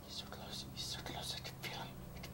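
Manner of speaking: hushed whisper while hiding; tense whisper. Real, not acted. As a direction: whisper so the monster won't hear. Hiding from a chasing monster.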